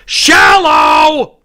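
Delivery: loud, high outraged yell; outraged shout. Real, not acted. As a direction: shout the word in disbelief. Outraged reaction.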